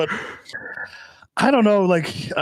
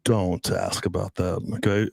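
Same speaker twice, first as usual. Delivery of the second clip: stern refusal; 6 semitones lower, 3 dB quieter than usual. Retold or imitated. Boyfriend refusing to tell girlfriend his dream, mock stern. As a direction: shut the question down, low and measured.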